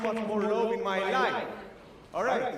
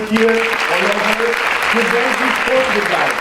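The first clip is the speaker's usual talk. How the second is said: rallying the crowd; 2 semitones higher, 14 dB louder than usual. Real, not acted. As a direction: hype the crowd for a huge round of applause. Calling for applause from the crowd.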